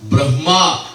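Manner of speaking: ordinary talk, nothing marked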